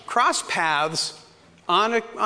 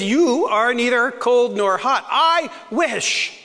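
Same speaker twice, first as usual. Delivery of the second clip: emphatic scripture; 4 semitones higher, slower than usual. Acted. Quoting Jesus with emphasis.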